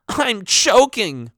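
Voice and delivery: high, slow dramatic cry; exaggerated yell. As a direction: overplay it like a panicking boy. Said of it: "one of the boys yelled".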